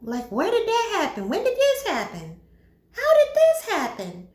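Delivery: mock disbelief, ending high; bewildered. Onlookers puzzling over the sudden couple, bewildered. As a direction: imitate baffled onlookers, pitch climbing with each question. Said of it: "everyone's looking around like".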